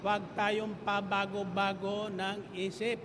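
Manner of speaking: loud, rising sermon delivery; full-force preaching. Acted. Pastor exhorting the congregation, loud preaching. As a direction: drive the point home to the congregation.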